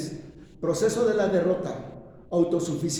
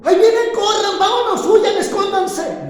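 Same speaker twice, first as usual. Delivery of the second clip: much higher, louder frantic yell to flee; mock panic; 13 semitones higher, 10 dB louder than usual. Preacher voicing the flight Jesus refused, frantic mock yell. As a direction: play a terrified man screaming at everyone to hide. Jesus did not say run.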